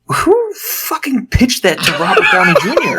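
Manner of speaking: high-pitched, laughing disbelief; incredulous outburst. Acted. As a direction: blurt it out in baffled outrage. Exasperated disbelief at the film scene.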